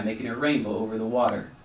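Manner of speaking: plain reading voice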